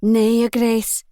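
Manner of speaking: the maid telling the Duke no in broad Scots. A narrator's reading. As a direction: shake your head in broad Scots. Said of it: "the maid answered".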